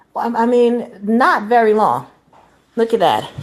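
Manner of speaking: ordinary talk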